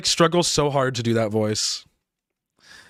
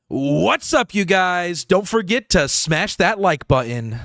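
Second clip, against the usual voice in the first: higher, punched-up content-creator intro; YouTuber voice; 4 semitones higher, slower than usual. Shown as a demonstration. Creator practicing content voice at the mic, hyped YouTuber voice. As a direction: hype it up, drawing out the words. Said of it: "like, a YouTuber voice".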